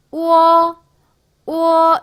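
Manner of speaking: 우어 as one long glide on a high level tone; pronunciation demo. Shown as a demonstration. Teacher showing learners how it reads, pronunciation demo. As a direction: hold 우어 high and steady, gliding from u into o. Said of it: "이렇게 되는 거예요".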